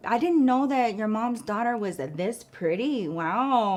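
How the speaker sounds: slow, leering mock compliment; creepy-nice older man. Older mechanic to customer's daughter, imitated creepy niceness. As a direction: imitate a creep drawing out his flattery. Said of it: "he was really nice in the creepiest fucking way".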